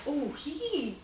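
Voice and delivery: soft, slow, drawn-out admiration; quietly savoring the mischief; approving relish. Retold or imitated. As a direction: say it slowly, like you secretly like it. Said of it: "I said it like that".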